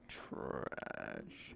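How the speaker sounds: low, drawn-out unvoiced growl; deep growled dismissal. Dismissive verdict on the name.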